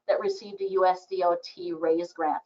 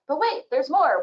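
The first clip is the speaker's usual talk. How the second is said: hyped higher, louder TV pitchman; infomercial voice; 9 semitones higher, 4 dB louder than usual. Acted. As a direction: sell it like a late-night ad. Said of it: "I start sounding like an infomercial".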